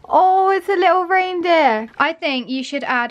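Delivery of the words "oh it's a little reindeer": delighted. Real, not acted. Opens advent door.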